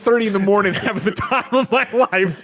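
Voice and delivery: laughing through the words; real laugh breaking into the words. Real, not acted. Laughing while talking.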